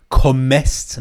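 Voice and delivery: کمست with an E slipped in, "ko-MEST"; wrong extra vowel. Shown as a demonstration. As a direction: stretch کمست into two syllables, ko-mest. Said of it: "نه ها".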